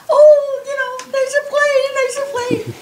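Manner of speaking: put-on very high, thrilled children's cry; excited shouting. Family kids dancing in yard at plane overhead, high excited cry. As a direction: squeal it like kids spotting a plane.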